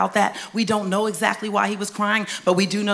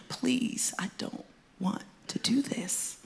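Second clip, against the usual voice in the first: fearful plea; 3 semitones higher, 8 dB quieter, slower than usual. Acted. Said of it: "why was Jesus saying".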